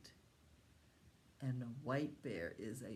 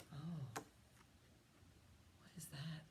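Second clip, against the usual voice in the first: breathy hushed wonder; slow wondering whisper; 12 dB quieter, much slower than usual. Acted. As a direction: draw it out in a whisper.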